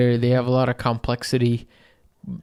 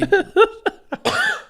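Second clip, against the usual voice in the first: choking cough with a laugh; coughing it out, half laughing; coughing up water; 4 dB louder than usual. Real, not acted. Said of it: "that went down the wrong pipe".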